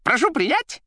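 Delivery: loud, very high squeaky politeness; telephone figure's voice. A narrator's reading. The receiver taking leave of the caller, squeaky and effusive. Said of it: "говорила трубка".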